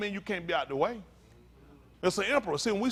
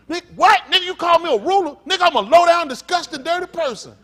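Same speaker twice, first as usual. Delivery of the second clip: very loud, high indignant shouting; hammed-up offended yelling; mock outraged quarrel; 10 semitones higher, 13 dB louder than usual. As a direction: act out a man taking furious offense. Acted quarrel over being called ruler.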